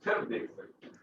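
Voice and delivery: soft unvoiced repeat of the answer; a quiet whispered aside echoing the reply. Real, not acted. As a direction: breathe the words back to the student. Teacher echoes student's answer.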